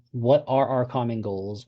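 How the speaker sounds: ordinary talk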